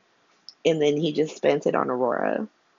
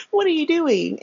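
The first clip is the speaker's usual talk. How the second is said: much higher, incredulous; mock disbelief; 11 semitones higher than usual. Sonny baffled at Curtis, much higher and incredulous. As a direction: stretch it up in disbelief.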